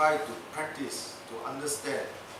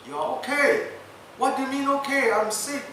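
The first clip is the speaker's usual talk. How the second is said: indignant protest; 9 semitones higher, 4 dB louder than usual. Retold or imitated. Doctor and governor bicker.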